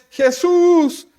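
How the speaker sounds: high, drawn-out cry for mercy; desperate distant shout. Acted. Blind Bartimeo calls to Jesús far off, desperate shout. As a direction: call out loud to someone far away, stretching each word. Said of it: "Y empieza a gritar".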